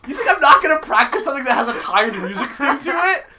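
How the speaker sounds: loud, high-pitched mock indignation; laughing. Real, not acted. Game master answers teasing about practice, laughing high and loud. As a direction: protest through laughter. Joking back amid laughter.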